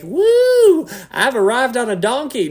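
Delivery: high, loud hammed-up whoop of triumph; mock gleeful whoop. Preacher mocking a happy donkey entrance, hammed-up whoop. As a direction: play a silly show-off cheering his own arrival.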